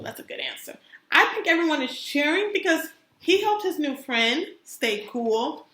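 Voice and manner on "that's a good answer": soft whisper of approval; whispered praise. Real, not acted. Teacher whispering praise to a child.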